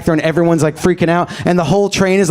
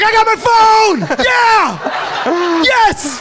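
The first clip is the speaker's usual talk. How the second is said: triumphant shout; much slower than usual. Retold or imitated. The phone's owner cheering with the whole train. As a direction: celebrate like you just won. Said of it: "he was like".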